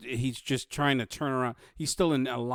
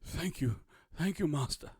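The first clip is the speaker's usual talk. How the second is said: soft, higher, gasping gratitude; weak thanks from a man just revived; wounded guard; 4 semitones higher, 5 dB quieter than usual. Acted. Wounded guard thanks his healer, weak and grateful.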